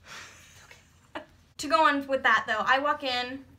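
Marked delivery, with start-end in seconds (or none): 0.1-1.2 soft whispered reassurance; comforting. Real, not acted. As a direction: gently soothe him. Comforting emotional partner.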